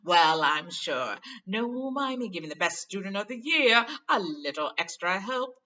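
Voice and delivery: loud, slow, self-satisfied showman; warm vain Lockhart. Acted. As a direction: bestow the favour grandly. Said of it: "said Lockhart warmly".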